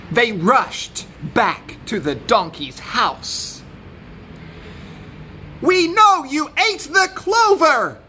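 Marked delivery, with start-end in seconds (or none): 5.6-8.0 very high, loud accusation; accusing. A narrator's reading. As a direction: point the blame loudly, pitched way up. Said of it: "accused the rooster and the goat".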